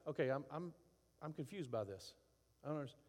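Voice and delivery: soft, honest admission of confusion; gentle, quiet, unguarded; calm confusion. Retold or imitated. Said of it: "Don't you mean, like".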